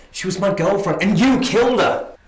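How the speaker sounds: loud outburst, rising on the last words; angry accusation. Acted. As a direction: hurl the accusation at full volume. Accuses her of killing his girlfriend.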